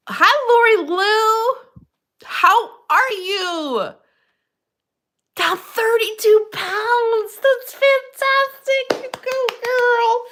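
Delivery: high, stretched, delighted cheer; cheering a viewer for losing weight, high and stretched; excited cheering. Real, not acted. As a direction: gush over her good news, slowly. Excited greeting, cheering weight loss.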